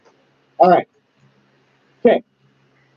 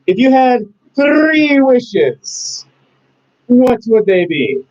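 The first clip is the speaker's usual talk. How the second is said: high, drawn-out genie proclamation; 7 semitones higher, much slower than usual. Acted. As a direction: grandly stretch out the wishes like a genie. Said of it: "I had to do it with my genie voice".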